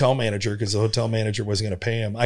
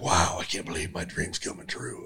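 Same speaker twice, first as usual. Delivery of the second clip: breathless hushed wonder; awed whisper; 5 semitones lower, 7 dB quieter than usual. Retold or imitated. Comic remembering his first big gig, awed whisper. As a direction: whisper it like a dream come true. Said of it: "I was just like".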